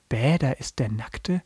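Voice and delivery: slow, unexcited repeat with questioning tone; baffled read-back of an exclamation; puzzled quoting. Retold or imitated. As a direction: quote it back, not getting it. Puzzled host repeats the line.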